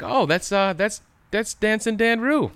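high, slow mock voice; mocking insurance company. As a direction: mimic a smug company rep. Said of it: "Progressive was just like".

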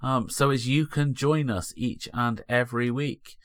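podcast announcer. Acted. Podcast outro read.

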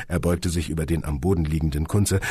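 ordinary delivery

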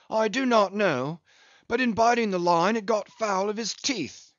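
put-on a rueful old English whaler telling his loss; a hint of a weathered English captain; Englishman captain. Englishman's tale.

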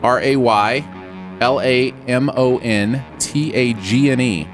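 usual speaking voice

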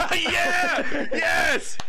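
high, loud whoop of joy; excited cheer. Real, not acted. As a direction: cheer in triumph. Celebrating the finish.

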